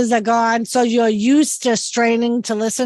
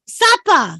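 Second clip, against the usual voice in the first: high, R-less Massachusetts "Supper"; Massachusetts accent; 5 semitones higher, 3 dB louder than usual. Retold or imitated. Repeating supper for the puzzled brother-in-law, thick Massachusetts accent. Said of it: "hard time about my accent".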